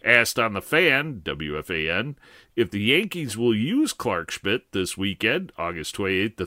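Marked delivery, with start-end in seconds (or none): none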